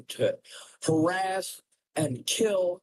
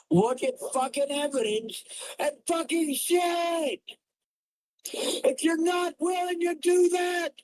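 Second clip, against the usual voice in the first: furious shout; 12 semitones higher than usual. Real, not acted. Streamer screaming at his chat, furious shout. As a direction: scream it at them in fury.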